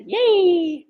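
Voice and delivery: a quick burst of praise for the dog; vocal encouragement. Shown as a demonstration. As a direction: demo of the happy cheer that rewards a dog. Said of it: "use your vocal encouragement".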